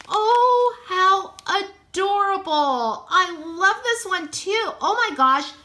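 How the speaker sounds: real excited squeal; delighted. Real, not acted. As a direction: gush over the bunny. Opening a cute figure.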